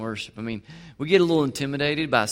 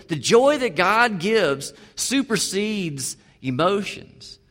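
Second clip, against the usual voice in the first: emphatic slow statement; 4 semitones higher, much slower than usual. Acted. Repeats key point slowly.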